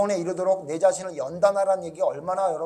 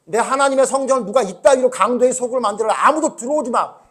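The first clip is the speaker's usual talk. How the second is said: loud, high, furious rebuke; Jesus's angry command; 7 semitones higher, 12 dB louder than usual. Retold or imitated. Jesus guarding God's temple from traders, angry command. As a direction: drive the intruders out with your voice. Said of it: "이랬던 예수님이에요".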